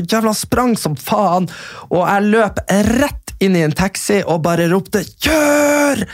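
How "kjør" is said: high urgent yell to the driver; shouting kjør. Retold or imitated. Fleeing the huge man, shouting at the taxi driver to drive.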